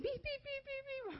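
high-pitched, soft alarm beeps; imitated beeping. Storyteller mimics the alarm she heard downstairs, imitated high beeping. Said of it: "I hear something beep".

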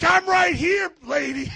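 high, very loud challenge; retold confrontational shout. Pastor squaring up to neighbor flicking him off, defiant yell. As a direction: re-enact squaring up to her, yelling.

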